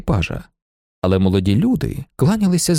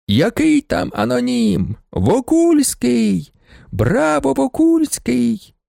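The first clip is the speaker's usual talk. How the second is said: high, drawn-out crowd shouts; clamouring crowd; 9 semitones higher, much slower than usual. A narrator's reading. Crowd shouting the true owner's name, clamouring. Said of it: "галасувала юрба".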